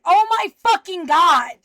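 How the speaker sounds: sudden loud, slow outburst; exasperated yell. Real, not acted. Speaker interrupted while finding a recording, exasperated yell. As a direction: burst out in real frustration, stretching the words.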